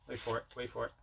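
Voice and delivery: ordinary talk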